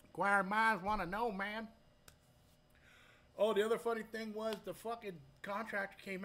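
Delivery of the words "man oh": ordinary talk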